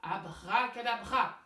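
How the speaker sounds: loud magician incantation; magic spell. Acted. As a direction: cast the spell like a stage magician. Magic word for the trick.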